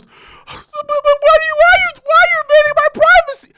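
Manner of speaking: put-on very high, fast squeaky girlfriend. Imitating girlfriend protesting privacy, squeaky high and fast. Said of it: "And she goes".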